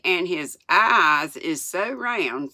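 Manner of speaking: ordinary delivery, nothing marked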